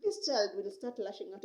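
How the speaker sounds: ordinary talk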